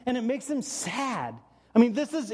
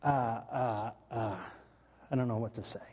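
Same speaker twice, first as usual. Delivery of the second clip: lower, halting, lost for words; sad stammering; 10 semitones lower, 5 dB quieter than usual. Retold or imitated. Jesus, hurt by the Pharisees, stammering sadly. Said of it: "he's just stammering around going".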